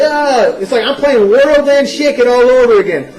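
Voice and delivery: high, laughing, slowed-down ridicule; laughing mockery. Gamer mocking himself waggling the remote, laughing hard. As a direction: laugh at how silly you look shaking the remote. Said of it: "You're like".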